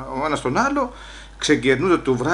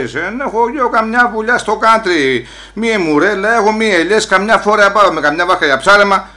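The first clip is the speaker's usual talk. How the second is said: a hint of raised, louder protest; 5 semitones higher, 5 dB louder than usual. Immigrant shouting at the police about his village, mild raised protest. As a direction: push back at them loudly about home. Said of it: "Μη φωνάζεις έτσι".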